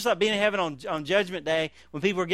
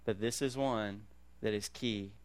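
hushed earnest advice; 7 semitones lower, 9 dB quieter than usual. Retold or imitated. Old believers passing wisdom to the young, hushed and sincere. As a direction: lean in and say it gently, like a confession.

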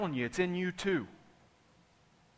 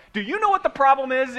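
loud, high yell of the question; 8 semitones higher, 5 dB louder than usual. Shown as a demonstration. Preacher demonstrates yelling the question, loud. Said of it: "Even if you have to yell it".

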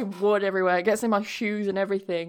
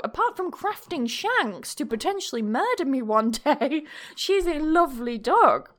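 normal talking voice

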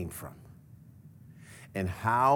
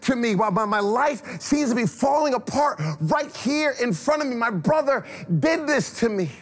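despairing cry; 9 semitones higher than usual. Acted. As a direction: cry it out high as a man whose life falls apart. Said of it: "Esau's like".

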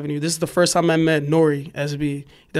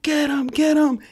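high, taunting voice of cops on a chase; imitated teasing cop yell; mocking cops; 11 semitones higher, much slower than usual. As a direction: jeer like bored cops having fun. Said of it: "They're like".

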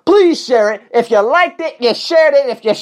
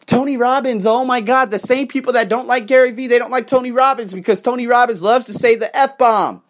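normal talking voice

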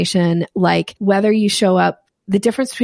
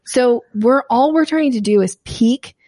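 usual speaking voice

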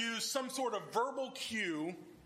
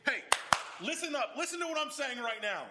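higher, emphatic call to listen; attention-grabbing call; 6 semitones higher, 3 dB louder than usual. Shown as a demonstration. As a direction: grab the room's attention. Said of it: "verbal cues to say".

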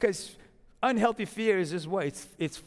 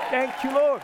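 shout of praise; 5 semitones higher, 3 dB louder, slower than usual. Real, not acted. Praise shout after prayer.

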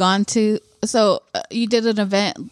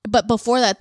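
normal talking voice

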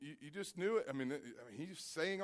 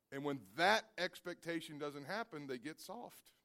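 ordinary talk

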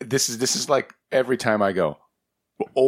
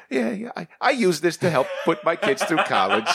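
put-on high old creator voice; 7 semitones higher than usual. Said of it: "it's just like".

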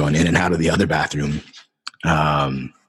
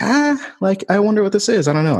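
much higher, a little louder, puzzled excitement; reliving the rush of strange energy; excited bewilderment; 3 dB louder than usual. Retold or imitated. Feeling energy build during Q and A, high excited puzzlement.

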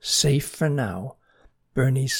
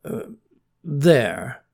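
faltering; 4 semitones higher, 5 dB louder, slower than usual. Acted. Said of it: "faltered Bernie".

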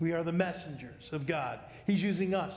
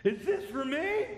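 higher, delighted disbelief with a laugh; surprised son; 8 semitones higher than usual. Retold or imitated. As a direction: squeal it like a teen seeing his first car. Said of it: "he was really surprised".

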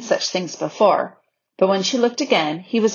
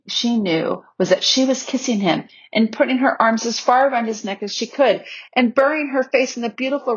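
ordinary delivery

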